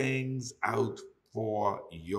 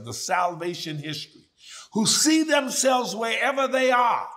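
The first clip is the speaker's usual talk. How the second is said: preaching at full force; 8 semitones higher, 7 dB louder than usual. Acted. Preacher to congregation, quoting the psalm, full preaching force. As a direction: lift the congregation with every phrase.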